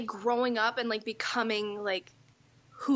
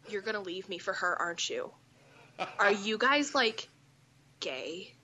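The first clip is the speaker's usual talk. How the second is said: put-on suspicious boyfriend; 5 semitones lower than usual. Jealous boyfriend, retold.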